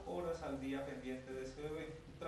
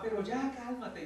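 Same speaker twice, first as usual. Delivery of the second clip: louder, higher exasperated order; a fed-up mother telling her child to stop; mother snapping at her child mid-tantrum; scolding mother; 6 semitones higher, 7 dB louder, slower than usual. Acted.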